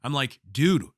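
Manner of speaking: usual speaking voice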